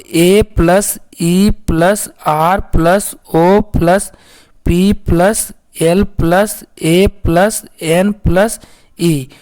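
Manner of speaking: letters spelled slowly with pauses; slow spelling out. Shown as a demonstration. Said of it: "अब देखिए airplane". (धीरे-धीरे spelling बताना)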